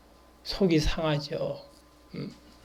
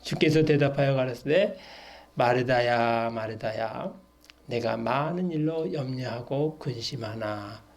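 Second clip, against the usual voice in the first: low, measured verse reading; scripture reading; 5 semitones lower than usual. Acted. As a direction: read the Lord's answer out solemnly. Reads Jesus' answer from scripture.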